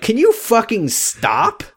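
high and slow, fed up; exasperated snap. Retold or imitated. Leo cutting off the arguing men, slow exasperated high. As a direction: drag it out, fed up. Leo snapping at bickering pair.

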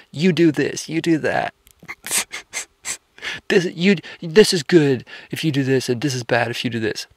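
high, bossy do-this-do-that tone; preachy lecturing voice. Shown as a demonstration. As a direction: lay down the rules like a preacher. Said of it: "If I talk that way".

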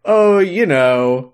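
exaggerated casual non-answer; mock evasive. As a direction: stretch the oh and dodge the question. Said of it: "And he's just like".

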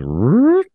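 high whistling root sound; imitated slide whistle. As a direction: mimic a reverse slide whistle. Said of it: "they had weird sound effect with it".

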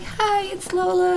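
high, slow put-on phone voice; phone greeting voice. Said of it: "You'll phone them up and be like".

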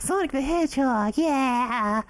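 put-on high squeaky kid "yeah"; a little kid cheering, high and bright; childhood voice. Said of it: "'Cause I was like".

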